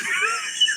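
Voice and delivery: short high burst of laughter. Real, not acted. Laughing at the memory of Colin Farrell's threat, high laugh. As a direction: laugh it off in a squeak.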